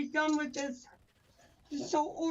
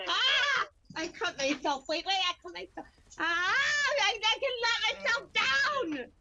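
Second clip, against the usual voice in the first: panicked; 7 semitones higher, 3 dB louder than usual. Real, not acted. Cut and restrained by officers.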